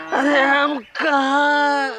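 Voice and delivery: very slow, rising-pitched bewilderment; dazed bewilderment. Acted. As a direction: wake up bewildered, drawing out each word. Wakes up not knowing where. (हैरानी से, खींचकर)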